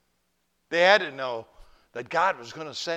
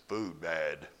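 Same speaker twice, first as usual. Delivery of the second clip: grumpy monk; 8 semitones lower, 5 dB quieter than usual. Retold or imitated. Monk's two words a year.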